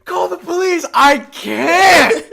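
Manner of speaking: high, loud mock-tearful scream; hammed-up desperate shriek; mock hysterics. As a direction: wail it in pretend panic, far above normal pitch. Mock panicked plea for police.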